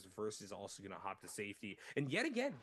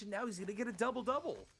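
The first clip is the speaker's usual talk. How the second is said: excited; 10 semitones higher than usual. Real, not acted. Caster hypes a big moment.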